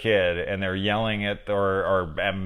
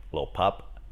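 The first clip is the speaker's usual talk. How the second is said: puzzled reading; 3 semitones lower, 6 dB quieter than usual. Shown as a demonstration. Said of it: "read it out loud and you'd be like".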